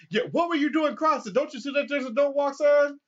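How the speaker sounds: high, loud mock cop; a cop scolding a jaywalker, high and loud; stern cop voice. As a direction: bark like an angry officer. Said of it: "the cop goes".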